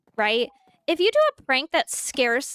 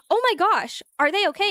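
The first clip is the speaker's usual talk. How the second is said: worried reply; 6 semitones higher than usual. Retold or imitated. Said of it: "that person goes".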